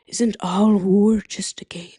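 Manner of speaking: breathy whisper. Pretend. Philosophical aside.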